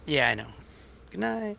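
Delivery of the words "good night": soft goodnight. Real, not acted. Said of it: "Just yell that to her now".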